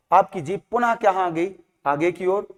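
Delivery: usual speaking voice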